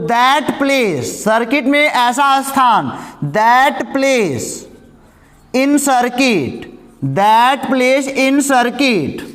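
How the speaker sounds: slow word by word dictation; teacher dictating the junction definition. Shown as a demonstration. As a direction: spell out the definition slowly for notes. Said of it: "यानी कि English में क्या लिखोगे".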